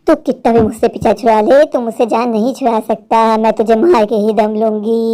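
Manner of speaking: deep, eerie vengeful witch. Acted. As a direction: growl it like a ghost bent on revenge. Witch reveals herself as Manjurika. (गहरी डरावनी चुड़ैल की आवाज़)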